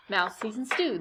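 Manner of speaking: ordinary delivery, nothing marked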